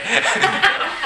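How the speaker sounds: silent wheeze of laughter; real airy laugh; breathless wheezing laugh. Real, not acted.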